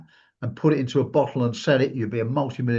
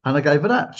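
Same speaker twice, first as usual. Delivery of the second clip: usual speaking voice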